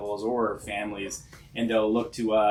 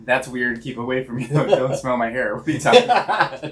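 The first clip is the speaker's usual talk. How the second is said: weirded-out laughing; 7 dB louder than usual. Retold or imitated. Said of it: "you might be like".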